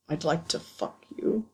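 hoarse low rasp of desire. Acted. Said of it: "Severus said hoarsely".

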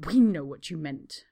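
ordinary delivery

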